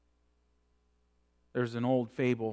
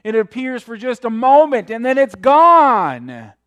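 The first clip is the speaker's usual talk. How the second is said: preaching at full force; 11 semitones higher, 17 dB louder than usual. Acted. Opening of the sermon.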